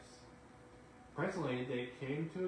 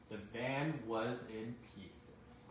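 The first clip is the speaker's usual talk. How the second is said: plain reading voice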